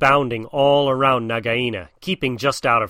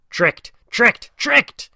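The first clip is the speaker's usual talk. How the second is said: each tricked higher and louder than the last; rising furious cry; 11 semitones higher, 5 dB louder, much slower than usual. Acted. Cobra shrieks as the family escapes, building higher and louder. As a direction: shout it three times, climbing each time.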